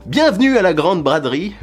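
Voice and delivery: sick vlogger forcing a burst of sale-host energy; announcer hype. Acted. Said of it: "je sais pas où je trouve cette énergie".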